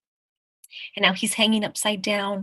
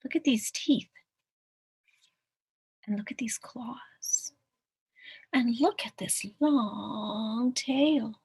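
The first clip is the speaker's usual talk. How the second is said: soft, hushed storybook marvelling; hushed wonder; 3 semitones higher, 6 dB quieter, slower than usual. Acted. Mouse nose to nose with the water, hushed. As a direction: hush it and stretch the last look. Mouse studies reflection closely.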